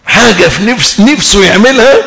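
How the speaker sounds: ordinary delivery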